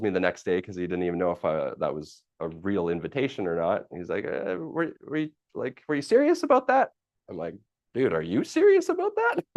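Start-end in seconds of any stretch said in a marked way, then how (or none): 4.2-6.9 much higher, rising disbelief; imitating a doubtful friend; Don incredulous. 7.9-9.4 very high, squeaky excited question; mock high-pitched excitement; excited echo. Echoing Don's question back.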